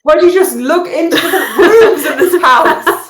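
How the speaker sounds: high-pitched sarcastic protest; exasperated. Acted. Game master as the annoyed owner to house guests, exasperated. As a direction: complain in hammed-up exasperation.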